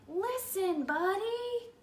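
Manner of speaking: high mock yell of his name; parents yelling. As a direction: call out high like fed-up parents. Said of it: "His parents tried yelling".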